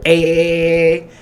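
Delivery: loud held "A" on one pitch; imitated button mashing. Imitating her hammering the toilet flusher, loud stuttered noise. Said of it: "That's how she was doing".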